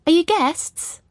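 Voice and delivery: put-on high, loud English-accented hail; bright-pitched English greeting thrown downward; calling down, English accent. A narrator's reading. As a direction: call out to someone below in English tones. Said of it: "she calls down in an English accent".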